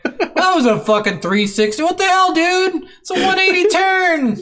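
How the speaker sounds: high, loud, incredulous protest; the player yelling at the unfair spin attack; mock outrage. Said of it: "I'm like".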